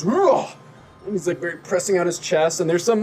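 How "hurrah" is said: high strongman grunt of exertion; strongman's effort cry. Acted. Strong man lifting a large branch, high effort cry. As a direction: heave out a showy effort yell. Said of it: "just goes".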